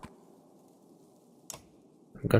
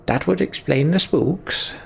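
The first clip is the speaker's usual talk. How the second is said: much higher, drawn-out character voice; wry game character; 9 semitones higher, 4 dB louder, slower than usual. Acted. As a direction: play it high and slow, with big swoops. Voices Bar's line in game.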